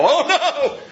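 high, loud mock panic with a laugh. Pastor laughing at his own panic over the news, high and loud. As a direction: squeal it like a fretting believer. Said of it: "These things come up and I go".

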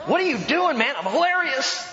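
high, silly voice of a giddy giver; mock cheerful giver, hammed up; goofy giver. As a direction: play it like a goofball proud of his joy. Acted exchange about giving.

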